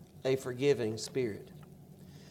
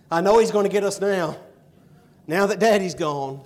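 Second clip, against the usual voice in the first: loud, high alarm, they're done for; the brothers voiced in loud panic; panicked brothers; 5 semitones higher, 9 dB louder than usual. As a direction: blurt out the fear loudly. Brothers fear Joseph's revenge.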